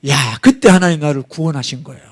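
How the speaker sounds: usual speaking voice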